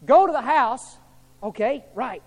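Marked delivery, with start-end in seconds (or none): 0.0-1.0 loud, firm divine command; God giving orders, loud and firm. Acted. God instructing Ananias.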